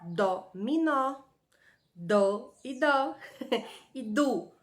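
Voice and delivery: Dó, minó drawn out slowly; playful teacher drawl; slow syllable play. Shown as a demonstration. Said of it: "Palavrinha que começa com dó?".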